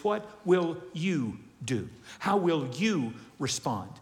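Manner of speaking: ordinary talk, nothing marked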